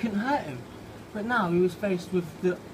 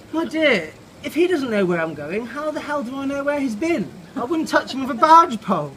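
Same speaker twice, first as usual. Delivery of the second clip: higher, louder haughty bird voice; snooty robin; 6 semitones higher, 6 dB louder than usual. Acted. Robin snubbing Cynthia about Norman, haughty and loud. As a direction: look down your nose at her, loud and lofty.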